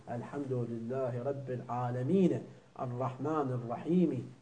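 الرحمن said arːaħmana, each word joined to the next by its final vowel; connected Quran recitation, final vowels voiced; recite joined with vowels. Shown as a demonstration. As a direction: flow الحمد لله رب العالمين الرحمن الرحيم together with final vowels sounded. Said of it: "أنت إذا تكمل يقول".

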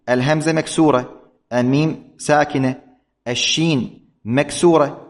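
ordinary talk, nothing marked

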